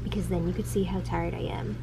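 hushed, breathy-free soft reading of the poem; softly reading a poem aloud. Acted. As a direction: read it low and dreamy, almost a whisper. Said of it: "You read that like the girl who did the".

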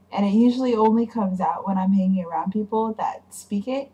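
ordinary delivery

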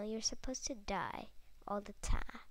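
ordinary talk, nothing marked

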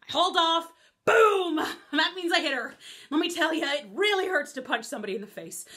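ordinary delivery

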